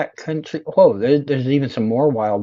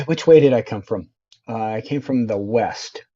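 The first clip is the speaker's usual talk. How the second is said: ordinary talk, nothing marked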